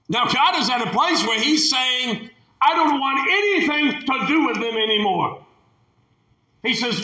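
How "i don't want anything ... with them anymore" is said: high, weary disgust; fed-up God. Acted. God disowning the people to Moses, high and fed up. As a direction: push them away in disgust.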